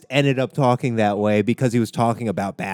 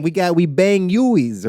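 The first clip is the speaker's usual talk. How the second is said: put-on slow, higher botched Boston accent; mock Boston accent; 8 semitones higher, slower than usual. Comic mimicking a Boston accent, slow and high. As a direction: drawl out a bad Boston accent. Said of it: "how you do the accent".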